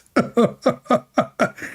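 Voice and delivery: another high burst of laughter. Real, not acted. Still amused by the comment, breaks into a high laugh. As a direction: cackle up high, airy.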